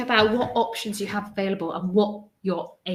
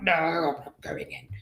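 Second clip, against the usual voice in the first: a dog's hushed refusal, whispered; whispered stubborn dog; 4 semitones lower than usual. Pretend. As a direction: whisper it like a dog digging its heels in. Voicing her dog refusing the vet.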